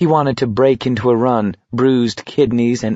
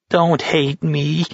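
slow, high pleading whine; high, plaintive whimper; whining; 6 semitones higher, slower than usual. A narrator's reading. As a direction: whine it, begging and drawn out. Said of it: "Markovich was whining".

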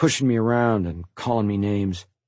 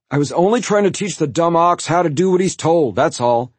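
defensive protest; 8 semitones higher, 5 dB louder than usual. A narrator's reading. Brother defends himself to mother.

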